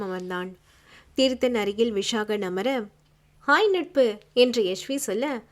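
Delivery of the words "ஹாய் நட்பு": higher, lively hello; bright greeting. A narrator's reading. Yashvi greets her friend, lively and high. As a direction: greet a friend with a lifted, eager voice.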